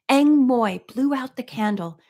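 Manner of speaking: ordinary delivery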